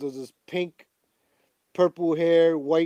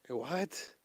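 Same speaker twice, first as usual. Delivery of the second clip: normal talking voice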